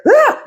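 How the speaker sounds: a short shrieked "Ah!" out of nowhere; hammed-up scream; mock outburst. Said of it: "Then he outburst at the wrong time".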